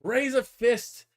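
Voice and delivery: high, loud, slow rallying cry; rally the comrades with drawn-out words; mock revolutionary speech; socialist agitator.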